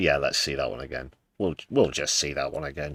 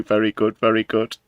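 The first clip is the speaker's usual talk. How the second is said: mock Keith; 4 semitones higher, 4 dB louder than usual. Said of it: "Not doing my best Keith voice there".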